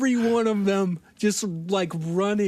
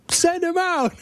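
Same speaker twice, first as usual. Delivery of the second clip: mock demanding yell; 10 semitones higher than usual. Gang yelling at Mike.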